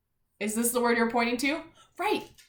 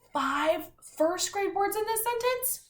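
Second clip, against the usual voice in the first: surprised; 11 semitones higher than usual. Acted. Teacher excited at count.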